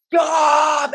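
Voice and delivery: high, loud cry to halt; re-enacted shout. Teller tries to halt the fight outside, re-enacted loud shout. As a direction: yell it to break up a fight.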